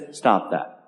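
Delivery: low, quiet, firm rebuke; a hushed but stern stop; fierce anger. Retold or imitated. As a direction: drop the voice and command it sternly. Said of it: "in the ferocity of his anger, he says".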